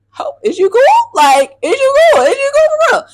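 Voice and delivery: high, loud mocking voice asking if she's cool; squeaky, exaggerated concern; mock concerned friend. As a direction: lift it way up, teasing the worried friends. Friends checking if she's okay.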